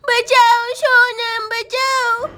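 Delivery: high, drawn-out plea for help; frightened toddler. Acted. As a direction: wail slowly like a scared little child. (मदद माँगते डरे बच्चे की आवाज़)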